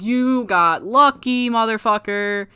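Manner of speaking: high, heated comeback, hammed up; mock yelling. [name] yells back at Valiant, high mock-angry retort. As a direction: stretch the first words in a pretend yell. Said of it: "[name]'s yelling at Valiant".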